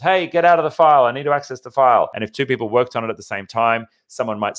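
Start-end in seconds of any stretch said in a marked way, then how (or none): 0.0-2.1 higher, louder call-out, easing off; mock office yell. Frustrated worker to colleague hogging a shared file, mock yell. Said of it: "yell across the office".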